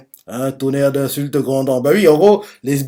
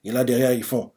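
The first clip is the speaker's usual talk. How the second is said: plain reading voice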